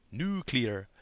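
Nuclear with a heavy NU, said nu-clear; first syllable stressed. Shown as a demonstration. Narrator shows Tommy's way of saying Nuclear, NU stressed. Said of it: "put more emphasis on the first syllable".

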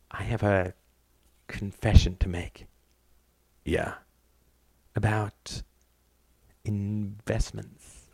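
soft, low and halting; hesitant confession. A narrator's reading. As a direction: confess it quietly, with long pauses before the hard words. Wife hesitantly confesses to husband.

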